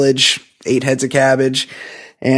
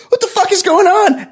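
much higher, shocked mock Miyagi; mock outraged Miyagi. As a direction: squeal it in disbelief as Miyagi. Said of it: "Miyagi's like".

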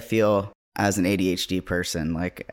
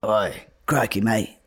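Steve Irwin impression. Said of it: "That's who I'm modeling it on".

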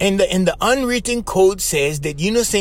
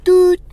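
a high, loud buzzer "Doot"; intercom buzz; 13 semitones higher, 7 dB louder than usual. Retold or imitated. Recalling the meeting office, imitating the intercom buzz loud and high. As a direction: mimic the office intercom going off. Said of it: "that buzz on the intercom".

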